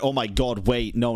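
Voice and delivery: ordinary talk, nothing marked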